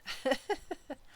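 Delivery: short high laugh at the line; amused little laugh; light laugh; real chuckle. Real, not acted.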